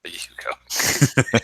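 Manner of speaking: breathy amused whisper; slow whisper, savouring it. Real, not acted. Repeats car name amused.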